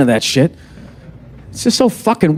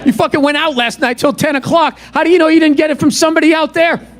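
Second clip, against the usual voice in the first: higher, accusing wife with a laugh; nagging wife; 8 semitones higher than usual. Retold or imitated. Wife blaming husband for the sickness, mock accusing. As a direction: needle him like a suspicious wife.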